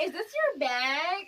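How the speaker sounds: slow, high, loud mock question; shady. Imitating Molly to the new woman, shady question. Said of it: "she was being real shady".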